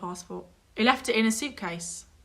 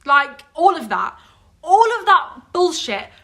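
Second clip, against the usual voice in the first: raised, louder outburst; indignant; 5 semitones higher, 6 dB louder than usual. Real, not acted. Friend ranting about all the needless fuss, indignant. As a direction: punch it out high and loud in outrage.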